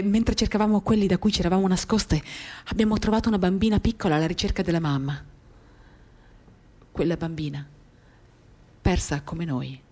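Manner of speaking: ordinary delivery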